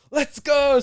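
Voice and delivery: high, loud burst of triumph; excited celebration. Acted. Player leaving the coach's office, jubilant shout. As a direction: let out a victorious cheer.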